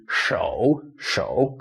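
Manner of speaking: "shou" dipping, then rising; "shou" as a careful Mandarin tone three; third tone. Shown as a demonstration. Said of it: "we can make a third tone".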